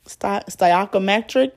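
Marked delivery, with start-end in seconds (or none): none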